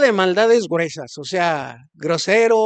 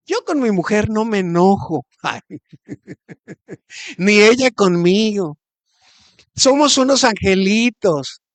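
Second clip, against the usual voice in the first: mock-innocent boast, a little louder; sarcasm; 5 dB louder than usual. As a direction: brag sweetly, meaning the opposite. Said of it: "es sarcasmo". (tono sarcástico, presumiendo en broma)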